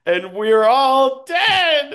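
loud, high, slow proclamation of doom; dramatic doom. Acted. Dying Slinky warning the party, loud, slow doom. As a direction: drag it out like a dire prophecy. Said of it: "Slinky's just like".

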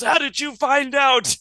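high, hammed-up anguished wife; wounded wife's cry. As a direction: wail it high as the shot wife. Shot wife cries out in story.

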